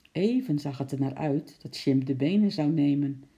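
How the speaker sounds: usual speaking voice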